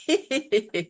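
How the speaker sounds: demonstrated light giggle; simulated little laugh. Shown as a demonstration. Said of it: "add a little giggle".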